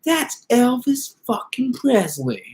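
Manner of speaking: slow, high, drawn-out awe; awed clerk. Retold or imitated. Clerk stunned [name] is in the shop, slow high awe. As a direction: stretch every word in amazement. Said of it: "And he goes like".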